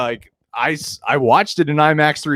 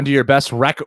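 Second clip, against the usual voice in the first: ordinary talk, nothing marked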